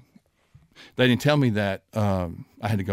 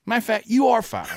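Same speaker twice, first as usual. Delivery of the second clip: high, louder hammed-up coach yell; mock furious firing; 14 semitones higher, 5 dB louder than usual. Head coach firing a rookie assistant on his first day, a loud high mock yell. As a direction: bark it out like a boss firing the rookie, in jest.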